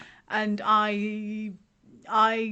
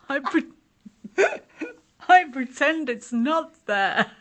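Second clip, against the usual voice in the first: higher, louder, breaking into laughter; laughing admission; 5 semitones higher, 7 dB louder than usual. Real, not acted. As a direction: confess it through the giggles. Laughing at her own coping method.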